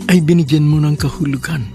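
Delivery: usual speaking voice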